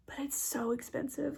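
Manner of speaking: breathy whisper, slowing down. Real, not acted. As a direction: drop to a secret whisper. Whispered aside about the price.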